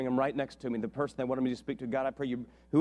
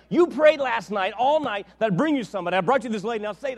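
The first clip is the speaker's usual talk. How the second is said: insistent God voice; 6 semitones higher, 8 dB louder than usual. Retold or imitated. God insisting to the preacher, mildly voiced command. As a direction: press him to obey. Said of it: "And he's like".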